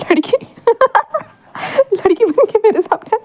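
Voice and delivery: cracking up at the sight; laughing. A narrator's reading. Wangji laughing at Wing dressed as a girl, high giggles. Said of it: "और तुम हंस रहे हो?".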